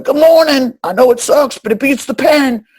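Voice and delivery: high, loud mock homeless-drunk voice; friendly drunk. Homeless drunk greets the street sweepers, high and loud.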